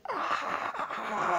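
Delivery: loud, high furious scream; scream of rage. Acted. Celestia unleashing her grief, a loud high scream of rage. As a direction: let out a raging scream. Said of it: "Celestia screamed".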